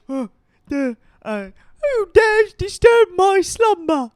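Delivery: cartoon minion. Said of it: "little minions that are like".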